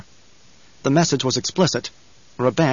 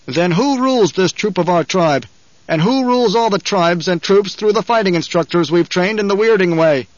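raised, higher-pitched questioning; challenging oratory; 5 semitones higher, 4 dB louder than usual. A narrator's reading. Paul asking the silent throng who rules, challenging. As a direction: put the challenge to the whole throng.

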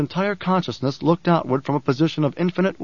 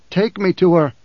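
louder, insistent repeat; firm repeat; 3 semitones higher, 5 dB louder than usual. A narrator's reading. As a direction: say it again so he hears. Repeats when not heard.

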